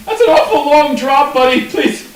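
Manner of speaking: high, scared Cockney halfling; halfling begging in a high Cockney voice. Acted. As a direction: panicky Cockney plea from a frightened halfling. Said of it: "He's like".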